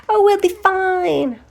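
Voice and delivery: put-on very high, drawn-out teen reassurance; carefree mimicry. Teenage guest waving off host's worries, airily carefree. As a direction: mimic a breezy teenager, stretched and high-pitched.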